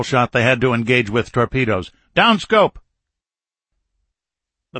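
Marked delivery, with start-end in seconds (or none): 2.1-2.8 high, loud sudden order; shouted command. Acted. Captain orders periscope down before firing, shouted command.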